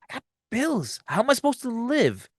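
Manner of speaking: high-pitched incredulous complaint; mock indignation. Character's indignant reaction, voiced.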